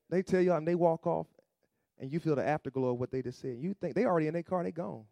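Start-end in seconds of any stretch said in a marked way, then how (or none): none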